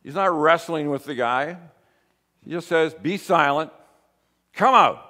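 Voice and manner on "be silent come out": raised retold command, building louder; forceful command. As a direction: act out a terse order, pause, then louder. Repeats Jesus's command to the demon.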